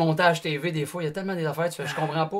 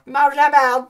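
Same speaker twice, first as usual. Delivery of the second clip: prank call yell; 8 semitones higher, 9 dB louder, slower than usual. Retold or imitated. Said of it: "puis on raccrochait".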